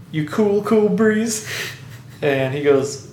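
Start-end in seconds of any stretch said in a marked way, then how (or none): 0.1-1.5 higher, cocky pilot voice; Iceman. Retold or imitated. Iceman checking on his rival at the bar, cocky. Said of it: "Iceman walks up and says".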